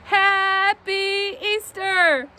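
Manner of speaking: high, loud, drawn-out cheer; festive shout. Real, not acted. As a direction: holler it slowly as a big reveal. Shouts while smashing egg on head.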